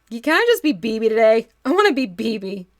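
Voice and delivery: loud, playful protest at full volume; loud outcry. Real, not acted. Reacting to a viewer's comment, a loud playful protest. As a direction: cry it out, begging to be B B. Reacting loudly to chat about BB.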